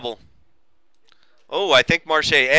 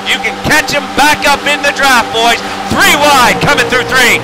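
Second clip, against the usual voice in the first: loud-and-clear, higher-pitched race call; live race play-by-play; 8 semitones higher, 5 dB louder than usual. Real, not acted.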